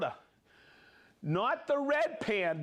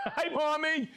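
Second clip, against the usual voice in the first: laughing hello; 9 semitones higher than usual. Real, not acted. Laughing greeting to a viewer.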